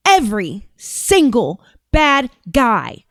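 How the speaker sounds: slow, over-deliberate official cadence; mock solemn vow, each word spaced out; Pam Bondi. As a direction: drag it out as if addressing small children. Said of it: "This is how she talks".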